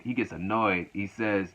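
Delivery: ordinary talk, nothing marked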